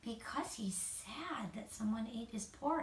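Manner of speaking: a real whispered explanation. Real, not acted.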